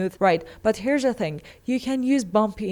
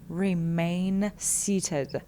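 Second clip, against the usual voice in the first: "Remain seated" drawn out very slowly; 4 dB quieter, much slower than usual. Shown as a demonstration. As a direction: stretch out "Remain seated" word by word. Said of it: "Next one is remain seated".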